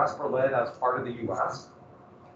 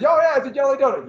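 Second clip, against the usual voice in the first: higher, louder and fast, a smug know-it-all; mocking know-it-all; 9 semitones higher, 6 dB louder, faster than usual. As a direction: toss off the joke like a smug tourist. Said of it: "So you hear people today say".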